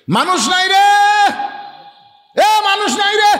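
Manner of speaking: very high, carrying holler; madman's street cry. Retold or imitated. Preacher imitating a madman calling out on the road, hollering.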